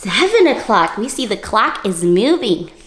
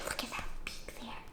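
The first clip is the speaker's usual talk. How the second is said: whisper; 22 dB quieter than usual. Real, not acted. Reader's awed aside to children.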